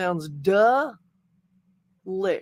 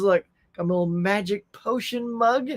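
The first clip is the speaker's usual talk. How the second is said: ordinary talk, nothing marked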